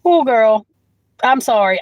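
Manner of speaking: usual speaking voice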